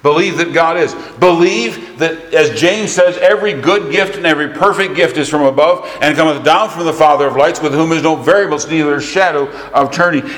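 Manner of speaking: loud, high, driving pulpit delivery; forceful preaching. Real, not acted. Preacher urging congregation to believe that God is, loud and forceful.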